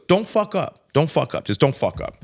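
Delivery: sharp high warning that fades over the repeats; attorney's warning. Retold or imitated. Attorney warning Henry not to mess up, urgent and emphatic. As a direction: hammer the warning then let it settle. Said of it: "he goes".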